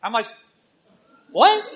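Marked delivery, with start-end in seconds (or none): 1.3-1.6 sharp high startled "What?"; surprised. Retold or imitated. Husband hearing his wife thinks he dislikes her haircut, startled. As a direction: jump up in disbelief. Surprised reaction to wife's distress.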